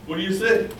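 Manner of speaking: usual speaking voice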